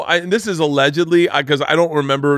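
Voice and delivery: ordinary talk, nothing marked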